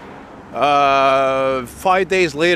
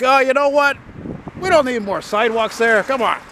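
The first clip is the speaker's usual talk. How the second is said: mock official; 8 semitones higher than usual.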